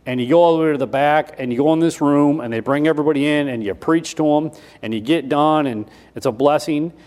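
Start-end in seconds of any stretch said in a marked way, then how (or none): none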